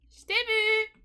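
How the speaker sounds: high, loud teasing call; triumphant gotcha; playful gotcha. Real, not acted. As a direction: catch them out in a game. Finding Sim in hide and seek.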